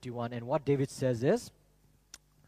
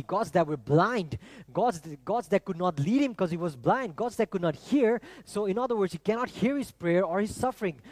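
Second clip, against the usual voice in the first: louder, higher, driving sermon delivery; forceful preaching; 3 semitones higher, 5 dB louder than usual. Acted. Preacher hammering false gods to the congregation, forceful.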